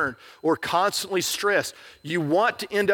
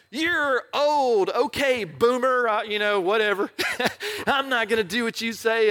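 put-on dismissive teen; 5 semitones higher than usual. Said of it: "And you're like".

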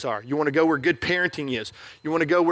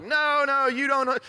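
defensive denial; 8 semitones higher than usual. Retold or imitated. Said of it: "And they're like".

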